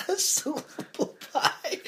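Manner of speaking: slow, high laughing words. Real, not acted. Laughing at the pun.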